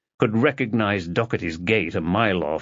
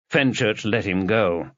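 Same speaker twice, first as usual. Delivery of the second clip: plain reading voice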